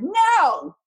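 loud, high-pitched "no"; emphatic refusal. Retold or imitated. Retelling her refusal of the agent's offer, loud emphatic no. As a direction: shoot the idea down at full pitch.

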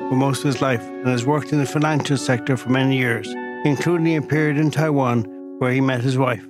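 polished, scripted intro read; podcast intro read. Acted. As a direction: read it like a show opener. Scripted podcast intro over music.

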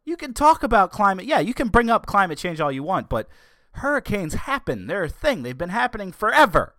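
fed-up commentator scolding critics; heated rant. Real, not acted. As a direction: hammer home that storms just happen. Continuing the exasperated rant.

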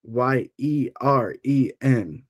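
letters said one by one, slowly; spelling it out. Shown as a demonstration. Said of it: "Can you spell that for me?".

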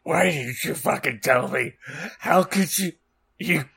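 high, strained outraged yell; hammed-up furious outburst, building to the last word; acted shout. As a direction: shout it in broken, indignant bursts. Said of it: "Draco shouted".

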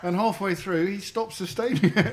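high, laughing delivery. Real, not acted. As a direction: giggle your way through it. Laughing while telling funny story.

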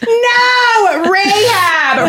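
long high wail of protest; exasperated protest. Real, not acted. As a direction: draw out a high-pitched, teasing correction. Laughing protest over mispronounced name.

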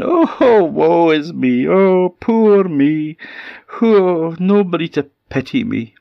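milk every "oh" for pity; self-pitying wail. Acted. Goat lying near the cave front, self-pitying wail.

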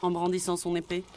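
plain reading voice

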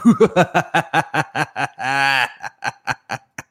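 long gloating villain cackle; evil laugh. Acted. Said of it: "You're an evil man".

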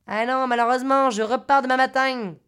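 put-on Marseille man's voice; sing-out Marseille accent; imitated Marseille accent.

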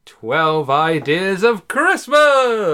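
drawn-out, loud announcer call; grand announcement. Acted. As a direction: announce it like a game show host. Announcing the podcast segment.

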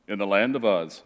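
"In the land of Uz" read low, like a dull droning recitation; deliberately dull and low, drained of life on "Uz"; boring reading. Shown as a demonstration. Pastor shows the congregation a boring Bible reading, low and dull. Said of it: "I'm kidding. I'm not gonna read like that".

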